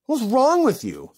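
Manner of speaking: high, loud incredulous scolding; shocked outcry. Real, not acted. Host to the sender of the card, appalled outcry. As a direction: cry out in disbelief.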